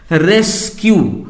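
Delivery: रेस्क्यू slowly split into RES and KYU; two syllables. Shown as a demonstration. Teacher showing a two-syllable word, रेस्क्यू slowly in two parts. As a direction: break रेस्क्यू into two drawn-out beats. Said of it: "ये डाइसिलेबिक है".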